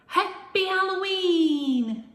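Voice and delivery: loud, high, slow holiday call. A narrator's reading. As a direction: sing it out to the kids. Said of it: "called Luna".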